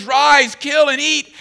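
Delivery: high, loud command; God commanding. Retold or imitated. Preacher voicing God's order to Peter, high and loud. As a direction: bark the order. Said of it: "and he goes".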